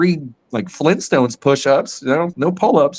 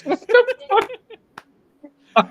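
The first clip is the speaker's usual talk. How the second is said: high, startled mock wife; imitated wife's shock; much slower than usual. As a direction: play the baffled wife walking in. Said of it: "She goes".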